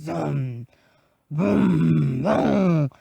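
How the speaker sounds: big booming storybook scare; scary sounds. Acted. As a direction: boom out mock-scary wolf noises. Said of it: "even scary sounds".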